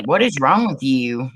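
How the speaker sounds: normal talking voice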